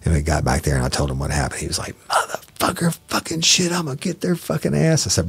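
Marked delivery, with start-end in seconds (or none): 2.1-5.1 much higher, strangled furious rant; imitated rage. Training officer cursing about the suspects, in strangled high-pitched fury. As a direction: spit it out in outraged disbelief. The FTO rages at the news.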